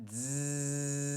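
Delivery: long buzzing zzz through the teeth; bee buzz. Shown as a demonstration. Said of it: "making this sound like a bee".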